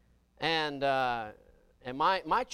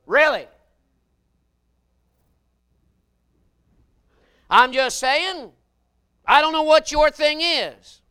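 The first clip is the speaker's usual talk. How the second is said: loud, high and slow preacher's holler; full-force preaching; 10 semitones higher, 14 dB louder, slower than usual. Acted. Preacher warning the church about sin, slow loud holler. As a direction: hammer each phrase at them.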